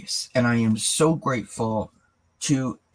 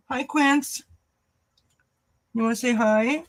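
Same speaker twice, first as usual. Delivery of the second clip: very high, singsong greeting to a little one; 11 semitones higher than usual. Real, not acted. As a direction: coo sweetly, pitched way up. Cooing at Quincy joining the stream.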